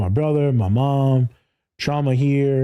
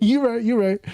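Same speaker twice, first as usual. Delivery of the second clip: higher, laughing concession; laughing mock agreement; 10 semitones higher than usual. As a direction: echo it back while laughing. Laughing echo in high voice.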